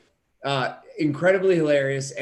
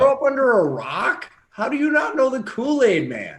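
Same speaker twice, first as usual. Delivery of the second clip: high, loud mock outrage; incredulous; 10 semitones higher, 4 dB louder than usual. Real, not acted. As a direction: tease him in disbelief. Teasing disbelief at friend.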